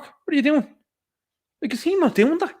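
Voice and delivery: high incredulous outburst; exasperated. Retold or imitated. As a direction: throw up your hands. Mock-exasperated imagined son.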